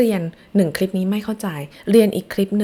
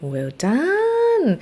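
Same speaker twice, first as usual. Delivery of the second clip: cheery praise; 12 semitones higher than usual. Acted. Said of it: "praising kids".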